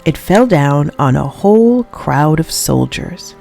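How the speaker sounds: ordinary delivery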